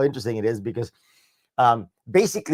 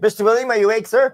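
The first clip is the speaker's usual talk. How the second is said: high, louder calling out; mock-polite raised-voice check; playful wake-up call; 11 semitones higher, 5 dB louder than usual. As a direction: call teasingly to a dozing listener. Playfully calls to waken William.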